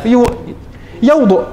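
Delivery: ordinary talk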